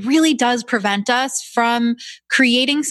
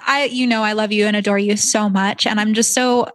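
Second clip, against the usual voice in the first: usual speaking voice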